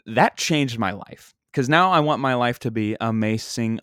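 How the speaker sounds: ordinary talk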